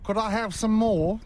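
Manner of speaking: high-pitched, mock British orphan plea; botched Australian accent slipping into English; Oliver Twist. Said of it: "I think that's Oliver Twist".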